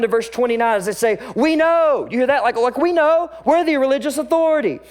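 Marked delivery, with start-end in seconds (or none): 1.3-2.1 high, loud and smug; mock haughty religious leaders; arrogant leaders. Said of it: "hear the arrogance dripping from their voice". 2.8-4.8 high, loud and smug; mock haughty religious leaders; arrogant leaders. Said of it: "hear the arrogance dripping from their voice".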